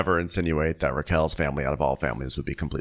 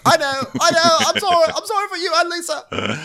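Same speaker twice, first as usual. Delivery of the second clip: much higher, louder falsetto pleading; mock whiny apology; 4 dB louder than usual. Mocking James apologising to his girlfriend, squeaky falsetto. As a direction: whimper a fake sorry in a squeaky voice. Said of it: "He's like".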